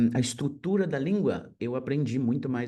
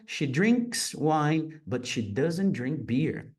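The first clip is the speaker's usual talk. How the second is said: slow teaching read; 2 semitones higher, much slower than usual. Shown as a demonstration. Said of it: "teacher model sentence, slow".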